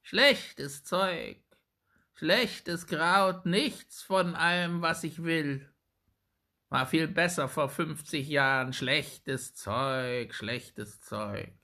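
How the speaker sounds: put-on high, strange old hag voice grumbling; old witch muttering. A narrator's reading. Old witch grumbling over the herbs, in a high hag voice. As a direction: sneer at the herbs like an old crone. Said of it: "murmelte sie".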